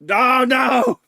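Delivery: high, loud cry of dismay; shout. Real, not acted. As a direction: yell oh no in mock despair. Overwhelmed by the big steak.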